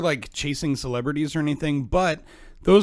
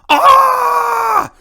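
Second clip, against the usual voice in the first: anime battle shout; 13 dB louder than usual. Acted. Anime attack cry.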